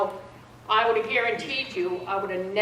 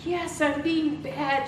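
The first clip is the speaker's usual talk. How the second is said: put-on higher, slow childlike admission; mother's voice; 6 semitones higher, slower than usual. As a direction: draw it out a bit higher as mom. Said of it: "she says".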